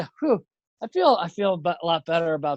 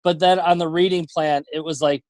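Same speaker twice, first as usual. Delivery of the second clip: ordinary talk, nothing marked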